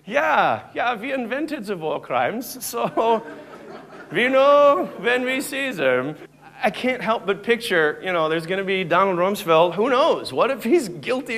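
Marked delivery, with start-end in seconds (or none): 0.1-6.2 hammed-up German voice, slow and proud; mock German accent. Stand-up comic mocks Germans in a hammed-up German accent. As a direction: play the smug German official.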